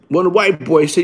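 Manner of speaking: ordinary talk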